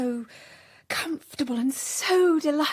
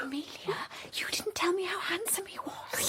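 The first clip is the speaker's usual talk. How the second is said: whisper; 3 semitones higher, 11 dB quieter, faster than usual. Acted. Becky asking Amelia about the shawls in a whisper. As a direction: breathe it so only Amelia hears.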